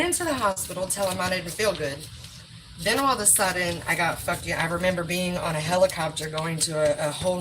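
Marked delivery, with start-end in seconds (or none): none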